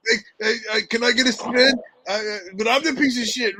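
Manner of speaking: high, loud mock-drunk pleading; imitating Jerry. Co-host mocking Jerry begging for a spin, high and pleading. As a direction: play the pestering friend begging for a turn.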